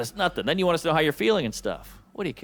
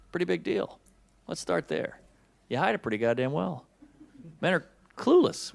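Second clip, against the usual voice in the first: usual speaking voice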